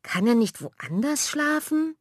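high, slow horrified protest; shocked outcry. Acted. Manja ruft entsetzt, hoch und gedehnt. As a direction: sister aghast at giving up her room. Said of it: "ruft Manja entsetzt".

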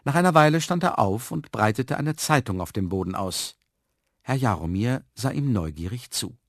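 plain reading voice

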